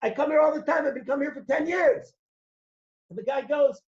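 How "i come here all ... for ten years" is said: loud, higher angry complaint; angry yell. Acted. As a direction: yell at the store clerk. You start yelling at the guy.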